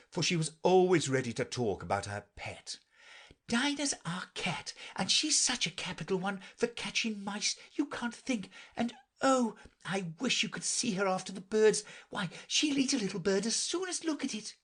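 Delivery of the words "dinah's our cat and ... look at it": put-on high, enthusiastic girl's voice; eager. A narrator's reading. Alice telling the birds about her cat, eager. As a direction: gush eagerly about a beloved pet. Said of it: "Alice replied eagerly".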